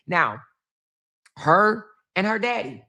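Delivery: ordinary talk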